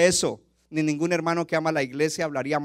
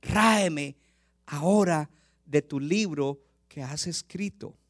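Moses' desperate plea; 4 dB louder, slower than usual. Acted. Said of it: "Y dice".

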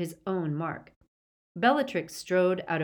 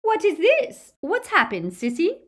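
very high, loud demanding woman; imperious high-pitched questioning; Bellatrix's high pitch; 13 semitones higher, 9 dB louder than usual. A narrator's reading. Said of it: "even higher pitch".

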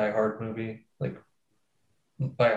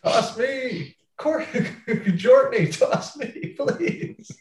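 high, hammed-up begging through laughter; mock desperate pleading; 13 semitones higher, 4 dB louder, much slower than usual. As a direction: beg melodramatically in a much higher voice. Acts out begging to be tossed.

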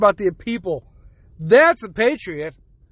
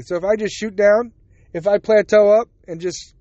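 ordinary talk, nothing marked

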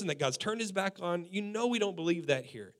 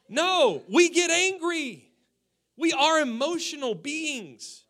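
higher, louder and slow; emphatic preaching; 7 semitones higher, 4 dB louder, slower than usual. Real, not acted. Pastor insisting to the church, loud and slow. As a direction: declare it with full force.

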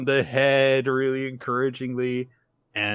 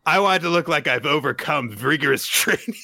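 put-on high, loud kid voice cracking up; imitated childish bravado breaking into a laugh; laughing boast; 7 semitones higher, 5 dB louder than usual. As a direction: proudly declare it, then lose it laughing.

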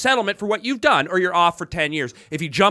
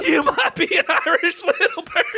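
high Southern punchline breaking into laughter; hammed-up Southern, barely holding back laughs; Southern accent; 9 semitones higher than usual. Comic landing his running punchline, laughing Southern accent. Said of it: "that was a Southern accent".

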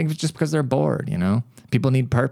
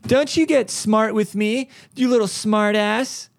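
put-on much higher, slow scolding old-lady voice; mock scolding Karen; 10 semitones higher, slower than usual. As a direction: wag a finger at the clerk in a shrill mock voice. Karen scolding sales rep.

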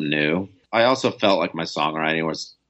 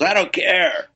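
higher, breezy brush-off from an eager fan; 13 semitones higher, slower than usual. Retold or imitated. Bartender insisting he'll come to Portugal anyway, higher eager brush-off. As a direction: wave it off happily. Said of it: "He goes".

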